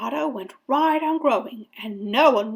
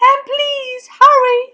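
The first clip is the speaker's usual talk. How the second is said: very high, loud plea; shout, pleading for speed; urgent yell; 10 dB louder than usual. A narrator's reading. Boy hurrying the firemen, high urgent yell. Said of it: "I yelled".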